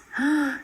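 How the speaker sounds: sharp high intake of breath; frightened gasp. Acted. Cousins seeing the knife-wielding men, a sharp gasp. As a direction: gasp at the sight of knives. Killers jump out with knives.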